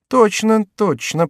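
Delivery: high, exclaimed confirmation; high double confirmation; agreeing keenly. Acted. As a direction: agree brightly, pitched well up. Chicot examines the coin.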